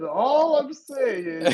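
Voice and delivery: slow, stretched, high mock glitch; imitating glitchy audio. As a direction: drag it out like choppy audio. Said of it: "All I heard C J says".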